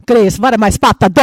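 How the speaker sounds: put-on loud, fast, heavy Russian accent; a stranded Russian traveller panicking in a heavy Russian accent; urgent plea in a thick Russian accent. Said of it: "in this heavy Russian accent".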